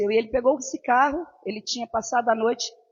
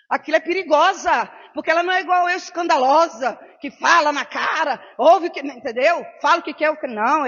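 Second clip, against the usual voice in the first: loud brash self-description; 2 semitones higher, 5 dB louder than usual. Real, not acted. Describing herself as the outspoken one, loudly. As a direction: belt it out like a loudmouth proud of it. Brags about being loud and blunt.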